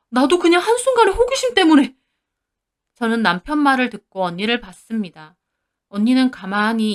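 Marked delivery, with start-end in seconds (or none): 0.1-1.9 higher, louder frantic denial; desperate husband. Acted. Husband denies to furious wife, frantic higher voice. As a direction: cry out that it isn't so.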